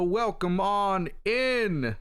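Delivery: slow hammed-up Texan welcome; mock cowboy drawl. Streamer welcoming chat in as a playful country cowboy, slow drawl. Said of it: "don't know why I said it like that".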